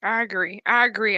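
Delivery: normal talking voice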